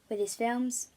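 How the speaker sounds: plain reading voice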